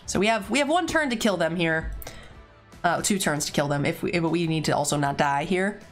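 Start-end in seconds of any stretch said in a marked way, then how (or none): none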